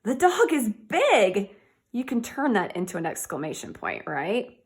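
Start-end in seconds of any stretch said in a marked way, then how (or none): 0.0-1.5 louder and slow, bright and punchy; excited teacher exclaiming about a big dog. Shown as a demonstration. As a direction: say it like it's amazing news. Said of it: "if you say it excitedly".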